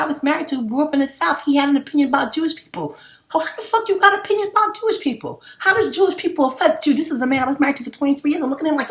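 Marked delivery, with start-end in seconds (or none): 3.3-6.9 much higher, disbelieving outburst; higher, incredulous outrage. Real, not acted. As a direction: scoff at the idea, voice jumping up. Outraged at ex-husband's opinion.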